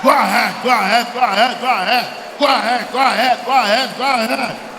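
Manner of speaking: loud, rhythmic repeated urging. Real, not acted. Preacher urges the congregation to pray, loud and insistent. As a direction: drive them on with an insistent chant.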